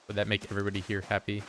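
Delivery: rattled off very fast, mocking the request; rushed sarcastic speed-up. Streamer mocks chat's demand, rattling it off very fast. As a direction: speed through it to spite the chat. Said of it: "Can you talk fast".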